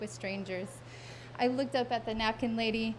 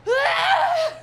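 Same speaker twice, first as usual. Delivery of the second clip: high, very loud wordless scream; triumphant yell; 11 dB louder than usual. Acted. Six-year-old yelling into mom's face, high loud scream. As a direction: let out a huge high-pitched "Aaah!". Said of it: "I yell into her face".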